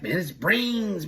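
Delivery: slow, eerie put-on voice; a slow, high, spooky put-on to fool the boss; faking crazy. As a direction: play crazy, drawing each word out. Friend faking madness on line.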